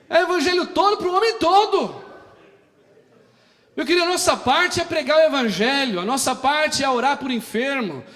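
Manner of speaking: high, loud, driving sermon cadence; full-force preaching. Acted. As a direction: hammer each line to the congregation. Prega a plena força. (pregação inflamada, alta e aguda)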